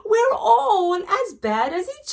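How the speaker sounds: high, laughing through the words. Acted. Mother laughing as she confesses, high. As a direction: laugh while admitting it. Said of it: "began to laugh".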